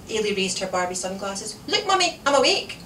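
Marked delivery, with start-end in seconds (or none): 1.7-2.8 put-on high, lively child's voice; little girl. A narrator's reading. Small daughter showing her mother, high eager child voice. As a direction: pipe up proudly like a small child.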